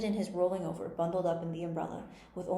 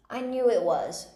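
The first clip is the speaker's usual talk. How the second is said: loud, higher-pitched outburst; crying out angrily; 5 semitones higher, 7 dB louder than usual. A narrator's reading. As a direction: burst out, voice up and loud. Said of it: "cried Tweedledum".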